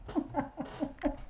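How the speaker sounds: soft, breathy run of chuckles. Real, not acted. Amused at own childhood memories, soft chuckle. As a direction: laugh softly to oneself.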